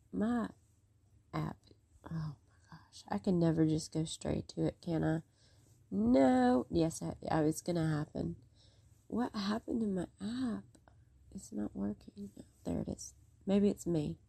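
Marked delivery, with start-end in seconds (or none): 0.1-3.0 breathy aside under her breath; whisper. Real, not acted. Frustrated with her app, whispered to herself. 9.1-13.1 breathy aside under her breath; whisper. Real, not acted. Frustrated with her app, whispered to herself. App not working mid-stream.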